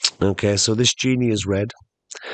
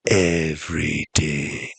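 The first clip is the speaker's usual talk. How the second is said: very low, slow confirmation; mysterious genie; 7 semitones lower, slower than usual. Acted. Genie confirming all to castaway, slow low voice. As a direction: drop deep and stretch every syllable. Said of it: "And the genie goes".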